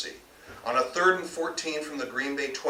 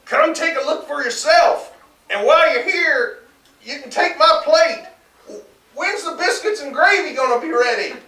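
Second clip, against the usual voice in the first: loud, high bellowing husband; calling out loud; 14 semitones higher, 10 dB louder than usual. A narrator's reading. Husband calling to his wife from his chair, loud and high. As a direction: holler it from the other room. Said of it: "Chester called out".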